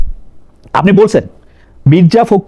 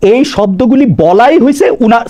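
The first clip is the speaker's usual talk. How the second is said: ordinary talk, nothing marked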